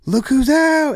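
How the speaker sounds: loud, very high-pitched mock exclamation; excited greeting. Attorney greeting his client on release, imitated excitement. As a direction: imitate someone hailing a surprise arrival.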